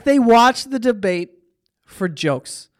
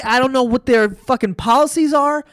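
ordinary delivery

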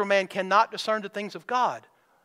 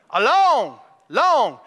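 both long drawn out louder and falling; stretched long; 3 semitones higher, 5 dB louder, much slower than usual. Real, not acted. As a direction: lean on and stretch each long.

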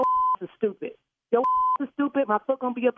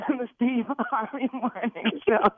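slow, laughing through the words; giggling answer; laughing after being pranked; slower than usual. Real, not acted. Victim laughing answering host.

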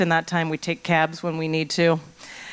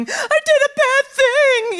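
put-on high, slow, teary wail of a child; crying like a child; 5 dB louder, slower than usual. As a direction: blubber the confession like a kid. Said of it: "start immediately crying and going".

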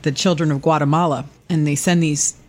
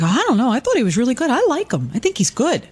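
higher-pitched defence of someone; retold polite disagreement; 7 semitones higher than usual. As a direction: push back politely in a raised pitch. Retold defence of pastor to his wife.

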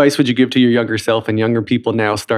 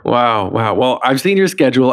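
usual speaking voice

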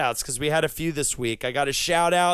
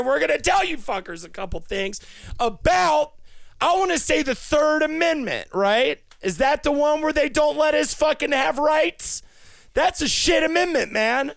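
ranting louder and higher into a shout; building rant; 9 semitones higher, 4 dB louder than usual. Acted. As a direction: work yourself up until you're yelling.

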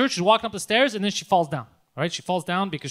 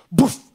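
loud "boof" of impact; punchy sound effect for a blow; imitated punch thud; 7 dB louder than usual. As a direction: throw out a sudden thud noise.

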